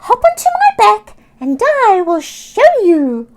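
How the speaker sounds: high, loud, fast squawking invitation; eager seagull. Acted. Sammy inviting Oscar to fly with her, high excited squawk. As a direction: squawk it eagerly like a bird full of fun.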